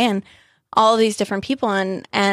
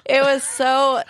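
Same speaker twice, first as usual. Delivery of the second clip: laughing through tears; 4 semitones higher, much slower than usual. Real, not acted. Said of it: "hard and moving and touching".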